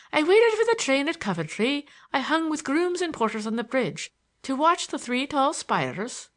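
lightly declaimed lines of Tennyson; quoting poetry. A narrator's reading. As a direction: recite the verse grandly. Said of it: "he quoted".

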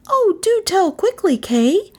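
put-on high, urgent girl's plea; pleading. A narrator's reading. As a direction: beg him to speak up, voice high. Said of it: "Gentian pleaded".

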